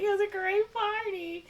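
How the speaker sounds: very high, airy sing-along praise; mock party gushing. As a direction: coo it up high, hamming the politeness. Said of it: "They're like".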